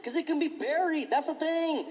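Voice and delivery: ordinary talk, nothing marked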